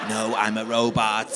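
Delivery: slow robot monotone; measured deadpan android. Acted. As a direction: declare it stiffly, like a robot. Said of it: "I can't keep talking like a robot".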